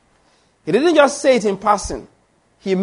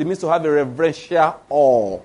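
mock reverence; 4 semitones lower than usual. Preacher quoting those who redefine fear, mock-reverent. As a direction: lower mocking voice, drawing out AWE. Mocking others' definition of fear.

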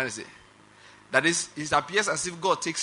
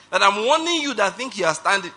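usual speaking voice

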